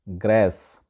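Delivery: ग्रेस with a flat "æ", graess; native English pronunciation. Shown as a demonstration. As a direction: say ग्रेस with the vowel of "cat". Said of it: "गोरे जो है इसको बोलते हैं".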